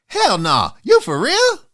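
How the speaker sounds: mock disbelief at cheeky friends; disbelieving squeak; incredulous. Real, not acted. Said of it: "incredulous reply".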